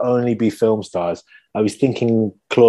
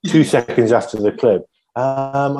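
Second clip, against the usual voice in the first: ordinary talk, nothing marked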